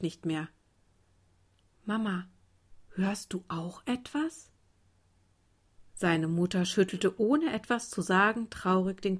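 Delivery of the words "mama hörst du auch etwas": put-on hushed, frail little child; quiet, weak. A narrator's reading. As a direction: ask very softly, saving your strength. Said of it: "sagte es leise, denn für mehr reichte seine Kraft".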